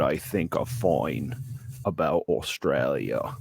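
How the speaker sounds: badly faked Aussie, slipping toward Michael Caine; exaggerated Australian accent. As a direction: present in a hammed-up Australian accent. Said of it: "in an exaggerated Australian accent".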